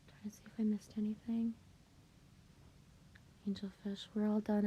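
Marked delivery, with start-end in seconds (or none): 0.1-1.5 breathy whisper, under the breath. Real, not acted. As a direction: murmur it to yourself. Quietly checking the screen.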